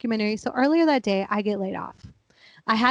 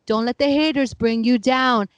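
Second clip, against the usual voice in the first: usual speaking voice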